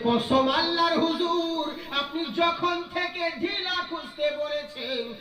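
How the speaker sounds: loud, high, slow preaching; preaching at full force. Acted. Preacher acting out the master and disciple, full force. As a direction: hammer every line to the crowd.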